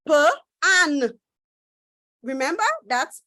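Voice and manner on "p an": "P, an" as puh, pause, an; chunked sounding-out. Shown as a demonstration. Teacher divides the word for pupils, "P, an" sounded puh... an. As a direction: sound "P, an" in two separate bits. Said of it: "you chunk this word into two".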